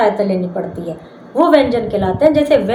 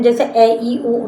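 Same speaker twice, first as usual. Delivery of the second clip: usual speaking voice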